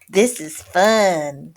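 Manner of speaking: slow, loud, drawn-out delight; little sister. A narrator's reading. Kitty sister watching Darwin sleep, slow and gleeful. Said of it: "said Jezebel".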